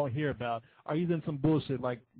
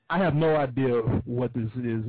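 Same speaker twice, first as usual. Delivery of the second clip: ordinary talk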